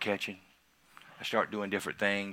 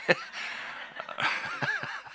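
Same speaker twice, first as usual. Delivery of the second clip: high airy burst of laughter; high breathy laugh; 4 dB quieter than usual. Real, not acted. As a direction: laugh at yourself. Laughing at own attitude.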